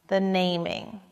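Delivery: usual speaking voice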